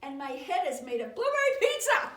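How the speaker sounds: loud declaration building to a shout; silly proclamation. Acted. Book forces a silly claim, loud hammed-up shout. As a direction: build up and bellow the absurd ending.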